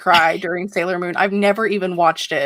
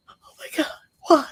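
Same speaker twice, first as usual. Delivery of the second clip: teary whisper; 9 semitones higher, faster than usual. Retold or imitated. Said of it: "and I'm just like".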